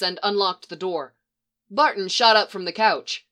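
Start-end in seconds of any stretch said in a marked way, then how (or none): none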